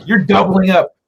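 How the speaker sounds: usual speaking voice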